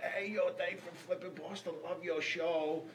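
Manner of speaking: high, eager fan voice; fan greeting. Retold or imitated. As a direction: greet him like a starstruck fan. Waiter recognizing him.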